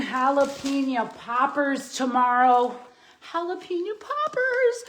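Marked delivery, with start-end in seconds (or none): 3.3-4.8 squealed way up high with delight; excited squeal. Real, not acted. Vlogger gushing about tomorrow's poppers, high excited squeal. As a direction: squeal the name like a treat.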